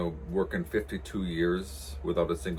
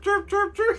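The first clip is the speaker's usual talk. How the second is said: very high squeaky chirping; high chick chirps; 6 dB louder than usual. Retold or imitated. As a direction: peep it like a tiny chick. Imitating the toy chick.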